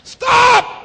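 sudden high, loud shout; shouted stop. Acted. Preacher stopping the scripture reading, sudden shout. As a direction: cut them off with a shout.

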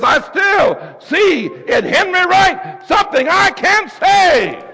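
high, loud, shouted praise; preacher rousing a calm choir; preaching at full force. Real, not acted. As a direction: cry it out in full fervour.

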